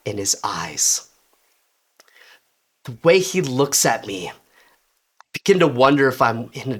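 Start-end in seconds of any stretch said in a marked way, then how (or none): none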